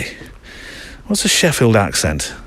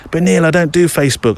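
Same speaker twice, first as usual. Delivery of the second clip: lightly voiced reluctant listener, higher pitch; mock objecting listener; 8 semitones higher than usual. Listener objecting to the host about joining, mock protest.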